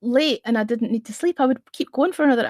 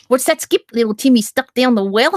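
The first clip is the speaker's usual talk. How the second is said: playfully bad Aussie accent, raised voice; mock Australian accent. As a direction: greet a visitor in a put-on Australian accent. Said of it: "whenever an Australian would come into my scopes".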